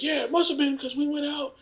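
very high, whimpering imitation; mock plaintive employee. Employee owning up to an argument, high mock-plaintive voice. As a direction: explain it in a high, put-on pitiful voice. Employee explains himself, acted.

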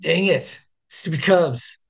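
high, exasperated mock grumble; annoyed at a catchy song; frustrated. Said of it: "you're like".

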